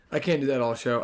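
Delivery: ordinary talk, nothing marked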